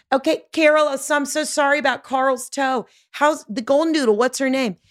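acted long-distance yoo-hoo; calling across the street. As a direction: call out to a neighbor over the road. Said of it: "This is me yelling from across the street".

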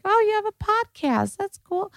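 very high-pitched old-lady impression; elderly dermatologist. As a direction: imitate an old woman bluntly sizing someone up. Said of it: "the eighty-year-old derm who was like".

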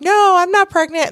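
squeaky high mock denial; high-pitched imitation of a disbelieving patient. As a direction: play an indignant patient swearing she's not pregnant. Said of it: "they see people who are like".

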